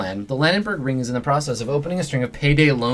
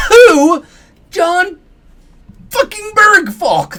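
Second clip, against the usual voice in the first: much higher and louder, screamed out; furious shriek; 10 dB louder, much slower than usual. Acted. Techie screaming his name at the intruder, high loud shriek. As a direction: scream it like a wired maniac.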